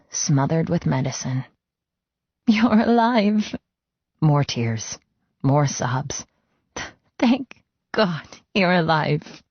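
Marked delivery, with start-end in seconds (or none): 2.5-9.4 tearful relief. Acted. Cleo sobs with relief.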